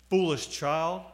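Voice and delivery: usual speaking voice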